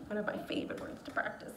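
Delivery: whispered fast, half to herself; a quick whispered aside. Real, not acted. Teacher whispering a quick aside to the students, fast.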